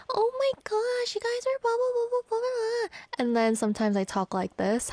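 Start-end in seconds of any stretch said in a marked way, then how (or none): 0.1-2.9 very high, gushing babble; squealing high voice. Shown as a demonstration. Host showing listeners how she sometimes talks, high and gushing. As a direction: gush way up high like an excited squeal.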